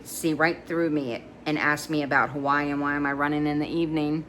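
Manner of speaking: plain reading voice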